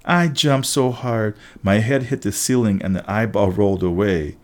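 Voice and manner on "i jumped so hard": plain reading voice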